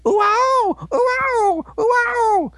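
high squeaky ow cries over and over; mock pained yelps. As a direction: yelp like a hurt little robot. Navi in pain, retold.

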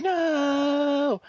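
long, high, drawn-out cry; mock anguished wail. Ian watches his partner get killed, mock wail. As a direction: stretch it into a hammed-up high wail.

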